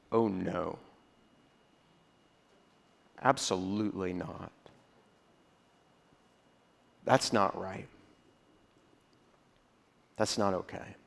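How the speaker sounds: low, soft retold "oh no", held back; quiet dismay. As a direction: murmur your disapproval under your breath.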